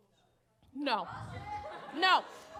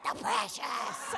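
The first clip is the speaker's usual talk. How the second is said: slow, hushed creature hiss; Gollum voice; 5 dB quieter, much slower than usual. Retold or imitated. Men answering her like Gollum, slow hushed creature voice. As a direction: drag it out like Gollum. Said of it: "a Gollum voice".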